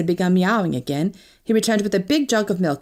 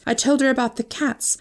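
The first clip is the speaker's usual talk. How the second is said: ordinary delivery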